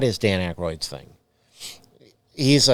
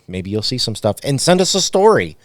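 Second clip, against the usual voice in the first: normal talking voice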